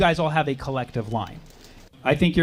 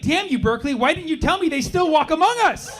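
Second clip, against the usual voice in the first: hammed-up indignation; mock outrage; 11 semitones higher than usual. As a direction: rage at the heavens theatrically. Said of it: "I was like".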